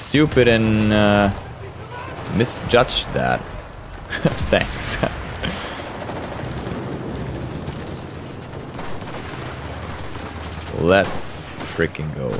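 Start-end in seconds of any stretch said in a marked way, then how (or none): 5.0-10.7 long quiet laugh. Real, not acted. Long laugh after mistake.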